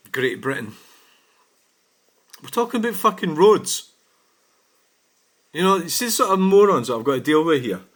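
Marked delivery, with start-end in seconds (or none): 2.4-6.9 higher, louder angry burst; exasperated outburst. Real, not acted. Driver ranting at a commenter who changed the subject, exasperated outburst. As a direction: blow up at how absurd the argument is.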